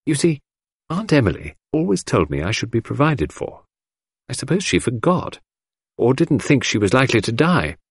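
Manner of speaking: a hint of a coaxing young girl; young girl Cynthia. A narrator's reading.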